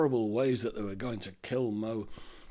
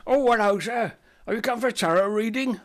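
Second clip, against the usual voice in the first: much higher, louder, eager shopkeeper; chirpy shopkeeper; 10 semitones higher, 6 dB louder than usual. Acted. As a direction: greet him like a fussy shopkeeper. Apothecary greets customer.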